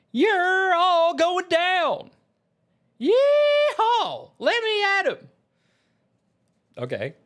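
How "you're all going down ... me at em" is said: very high, drawn-out Southern battle holler; Berserker Sissy. Acted. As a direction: whoop a berserker's attack cry. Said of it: "start reading the attack lines".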